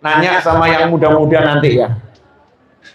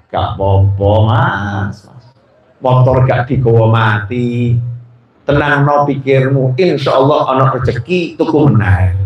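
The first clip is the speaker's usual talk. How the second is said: soothing woman; 8 semitones lower than usual. Acted. Sweet comforting woman friend.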